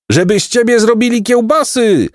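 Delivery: high-pitched cursing call; angry cry after someone. A narrator's reading. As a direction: shout the curse after him, pitched high. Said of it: "krzyknął za nim Jacek". (wysoki, gniewny okrzyk)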